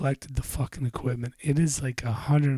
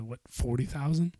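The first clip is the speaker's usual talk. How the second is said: usual speaking voice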